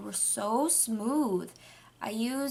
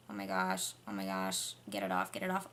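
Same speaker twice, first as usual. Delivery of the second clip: low, panicked get it off; phobic panic; 13 semitones lower, 8 dB quieter than usual. Real, not acted. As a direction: squeamish panic over a hair.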